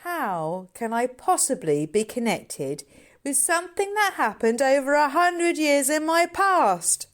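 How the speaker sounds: a hint of a bewildered girl, slowing at the end; girl Sandy. Sandy voiced in the story.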